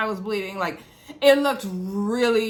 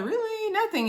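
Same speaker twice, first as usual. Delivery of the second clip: squeaky high, mock disbelief at her new man; high incredulous quote; 12 semitones higher than usual. Recapping the show, mimicking Chloe's high disbelief. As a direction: pitch way up: really, nothing?. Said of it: "And she was like".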